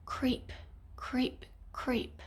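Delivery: soft, hushed creep, creep, creep; whispered creeping. A narrator's reading. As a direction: tiptoe the words out quietly. Sneaking baddies, whispered creeping.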